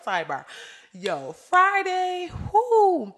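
ordinary delivery

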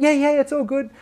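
high, too-breezy reassurance; brushing off worry. Retold or imitated. Reassuring parents on phone, retold.

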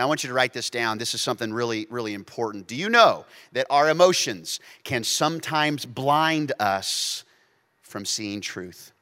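ordinary talk

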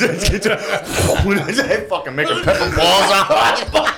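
high, slow words through laughter; laughing. Real, not acted. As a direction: talk while cracking up. Laughing at spicy food joke.

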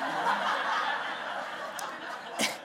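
breathy burst of real laughter. Real, not acted. Pastor cracking up while telling the congregation the story.

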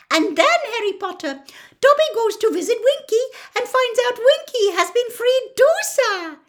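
high, loud squeaky elf voice, delighted; bubbling over with good news; delighted house elf. A narrator's reading. Dobby telling Harry about Winky, high and delighted. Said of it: "said Dobby delightedly".

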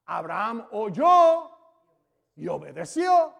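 slow, loud and weighty, each word hammered; preacher pounding the point; slow emphatic preaching. Acted. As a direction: drive home that he obeyed.

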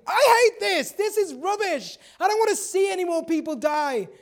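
loud, high-pitched venting yell; acted exasperated shouting. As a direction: let it all out at full voice. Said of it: "she starts yelling".

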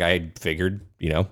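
soft, offhand; quiet shrug. Retold or imitated. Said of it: "He's like".

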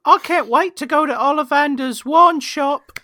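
high, hammed-up Potter-style kid voice; eager young wizard. Young wizard heading to Ollivander's, eager put-on voice. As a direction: pipe up like a thrilled little wizard.

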